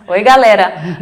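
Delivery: loud, laughing hello to the fans; excited greeting. Real, not acted. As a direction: call out warmly to the crowd in Portuguese.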